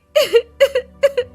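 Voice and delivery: high, loud cartoon bawling; loud sobbing. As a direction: burst into noisy wailing sobs. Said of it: "जोर-जोर से रोने लगती है". (ज़ोर-ज़ोर से रोना)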